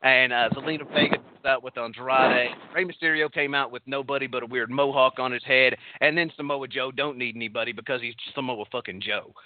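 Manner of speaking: thick put-on Texas twang; Texas accent. Shown as a demonstration. Host recapping wrestlers' entrances to prove it, in Texas accent. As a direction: recap it like a good old Texan. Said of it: "convince me you could do a Texas accent".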